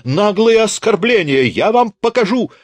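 put-on affronted old teacher shrieking high; outraged shriek. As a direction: screech the threat in outrage.

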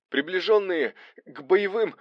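high, broken-up shout mid-fight; shouted between exertions. A narrator's reading. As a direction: cry it out in slow, high bursts. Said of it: "выкрикнул Арсений".

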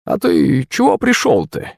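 high, slow suspicious granny; wary old woman. A narrator's reading. Crone questioning her visitor, wary. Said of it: "подняла настороженный взгляд".

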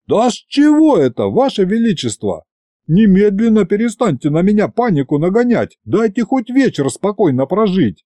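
high, agitated pleading; panicky protest. A narrator's reading. Servant begs the tsar to stop scaring him, high and panicky. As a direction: protest with rising alarm, pitch up high.

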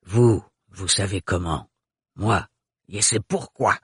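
put-on low guttural old woman. A narrator's reading. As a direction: stress the contrast, low and sly. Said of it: "avec son accent très caractérisé".

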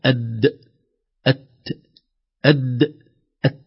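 "أد" voiced and stopped, "أت" breathy; letter contrast. Shown as a demonstration. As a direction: alternate "أد" and "أت" crisply. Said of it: "لاحظوا". (مقارنة "أد" و"أت")

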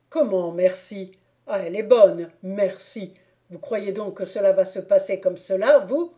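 put-on loud indignant drunk, rising at the end; outraged Thibault. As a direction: protest the mere thanks.

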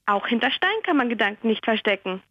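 ordinary talk

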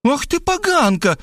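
high female voice in rage; enraged woman. Acted. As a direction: spit the insult out. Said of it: "озверела Светлана". (высокий разъярённый женский голос)

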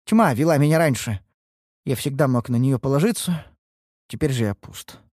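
lightly voiced downcast young man, pausing between phrases; dejected apprentice, halting. Apprentice explaining his failure to his master, halting and dejected. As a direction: confess haltingly, a touch of despair.

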